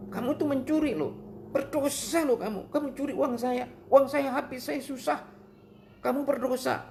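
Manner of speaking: very high-pitched demo of a scolding; admonishing anger. Shown as a demonstration. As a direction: act out a controlled, high-voiced reproach. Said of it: "marah itu menasehati".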